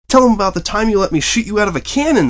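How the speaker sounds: high, loud eager filly shout; young filly bursting with excitement; excited exclaim. A narrator's reading. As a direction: blurt it out from the back of the room. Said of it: "the filly exclaimed".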